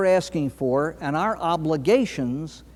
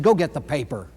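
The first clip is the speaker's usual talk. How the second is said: mild stern mother's command; a touch of a cross mother; understated annoyed order; angry mother; 3 semitones lower than usual. Acted. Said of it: "in anger, she said".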